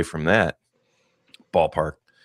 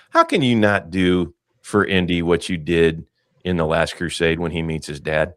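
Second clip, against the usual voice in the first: ordinary talk, nothing marked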